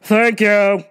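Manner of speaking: loud, demanding customer call; imitating the pushy shopper demanding service, loud; entitled customer. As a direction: snap for attention like you're owed it. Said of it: "she was like".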